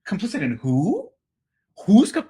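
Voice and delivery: loud, high, disbelieving question; incredulous. Acted. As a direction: balk at an absurd accusation, loudly. Disbelief at being called complicit.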